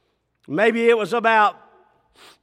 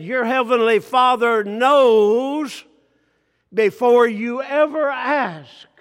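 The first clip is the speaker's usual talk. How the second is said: slow proclaimed scripture; 5 semitones higher, 6 dB louder than usual. Acted. Preacher quotes Jesus.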